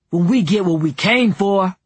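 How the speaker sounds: loud, belligerent comeback; defiant kid. A narrator's reading. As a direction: refuse to back down, loudly.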